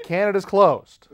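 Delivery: high, loud official announcement; Canadian lady announcing. Retold or imitated. Lady tells traveler the border is shut, announcing.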